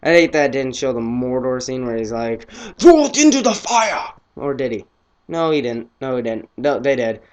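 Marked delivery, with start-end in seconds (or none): 2.8-4.1 high hammed-up shout, imitating the wizard; mock shout. Kid retelling the Mordor scene, hammed-up high shout. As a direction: bellow it like a wizard in a movie.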